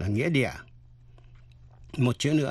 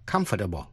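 "comfortable" stressed on the first syllable; 5 semitones lower, faster than usual. Shown as a demonstration. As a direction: put the weight on the start of "comfortable". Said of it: "nhấn mạnh phần đầu".

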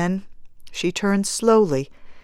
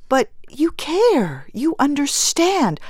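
earnest appeal; 9 semitones higher than usual. A narrator's reading. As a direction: press the fellow mourner to agree. Bereaved mother appeals to fellow mourner.

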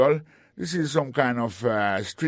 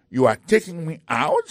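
indignant surprise; 5 semitones higher, 5 dB louder than usual. Acted. Indignant about being thrown out.

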